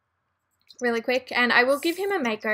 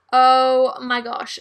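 excited; 8 dB louder, slower than usual. Real, not acted. Bo can age up.

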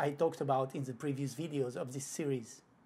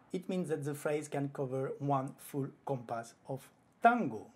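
ordinary talk, nothing marked

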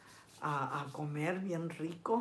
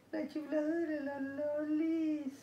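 much higher, slow cooing "La chiflada de la Lolis"; singsong pet talk; 8 semitones higher, slower than usual. Pretend. Teasing her cat, repeated.

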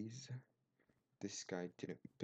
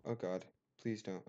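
ordinary delivery

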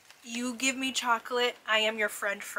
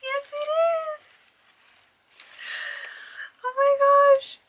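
high, loud squeal of delight; real overjoyed outburst; thrilled squeal; 14 semitones higher, 7 dB louder, slower than usual. Real, not acted. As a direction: shriek with joy at the surprise. Recognizing the anniversary ornament.

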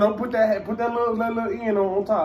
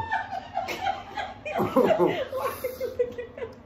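pained laughter; 6 dB quieter than usual. Real, not acted. Pained laugh during chip challenge.

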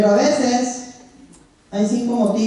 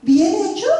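put-on much higher, childlike echo of the praise; little girl repeating; 9 semitones higher than usual. Toddler repeating the teacher's praise, high childlike voice. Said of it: "se limpia su carita y dice".

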